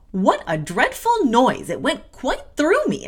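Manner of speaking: acted indignant outcry; Miss Abbott. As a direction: exclaim it with exaggerated, swooping shock. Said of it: "exclaimed Miss Abbott".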